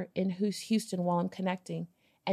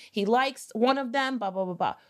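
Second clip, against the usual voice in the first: ordinary talk, nothing marked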